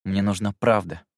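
mildly defiant answer. A narrator's reading. Narrator answers Jerome's doubt, with mild defiance. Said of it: "с вызовом ответил".

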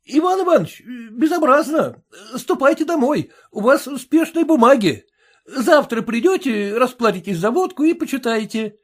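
put-on a tavern keeper rousing a sleeping drunk; barkeeper voice. Barkeeper waking drunk clerk.

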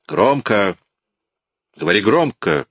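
shouted order. A narrator's reading. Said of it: "крикнул Жавер".

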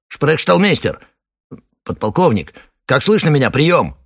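loud, high radio call; calling over radio. Acted. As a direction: shout down a bad line.

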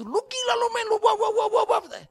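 high, loud mock tirade; hammed-up scolding in gibberish; nonstop ranting. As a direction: imitate an angry rant dissolving into babble. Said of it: "Nyocor terus".

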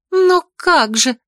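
high, slow dismayed cry; dismayed girl. A narrator's reading. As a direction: throw up your hands in dismay. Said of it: "всплеснула руками девица".

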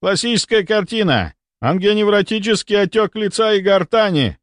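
high, urgent doctor's call; yelled diagnosis. A narrator's reading. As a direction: announce it urgently to the whole team. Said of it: "заорал Мельников".

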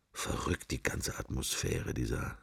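hushed musing whisper. Acted. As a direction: let the thought slip out under the breath.

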